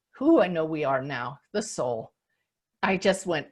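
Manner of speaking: usual speaking voice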